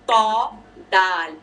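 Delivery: तौ said heavy and full-mouthed; slow letter demo; heavy taw. Shown as a demonstration. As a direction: say तौ heavy, then दाल light. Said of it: "ता और दाल smiley है, तौ heavy है".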